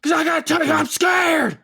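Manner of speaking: high frantic yell of fear; mock scared shout. Imitating a frightened pundit, high shout. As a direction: shriek that you are scared. Imitating Alex Jones freaking out.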